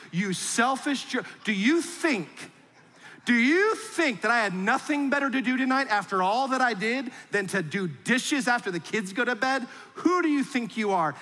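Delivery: high, indignant mock scolding; hammed-up exasperated rant; mock spouse rant. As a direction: play the tired spouse venting over dishes. Spouse ranting over dishes.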